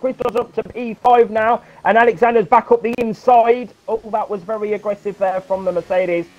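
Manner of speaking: hyped-up play-by-play; excited commentary. Acted. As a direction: call the battle with rising excitement.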